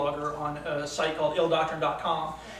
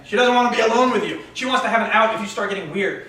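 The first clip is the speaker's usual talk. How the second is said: higher-pitched chastising voice in the head; heckling inner critic; 3 semitones higher, 3 dB louder than usual. Acted. Little hater chastising the guy on a date, heckling. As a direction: needle him as his own inner hater. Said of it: "The little hater started in again".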